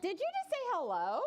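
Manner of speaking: high, quick, surprised asking; surprised question. A narrator's reading. Boy hearing a tree talk, surprised question. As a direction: pitch it up in disbelief. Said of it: "the boy asked".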